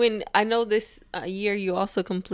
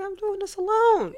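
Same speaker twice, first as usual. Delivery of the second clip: proud declaration; 13 semitones higher than usual. Retold or imitated. Proud reply to onlookers.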